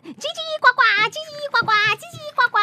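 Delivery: high, fast mock croaking chatter; frog chatter. Storyteller mimics the bickering frogs, high and fast. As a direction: chatter away like squabbling frogs.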